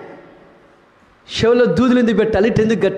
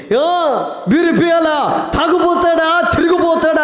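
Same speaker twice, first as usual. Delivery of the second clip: nagging challenge; 7 semitones higher than usual. Retold or imitated. Wife nagging her drinking husband, a higher mock shout. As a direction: confront the drinking husband mockingly. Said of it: "అని నువ్వు అన్నావనుకో".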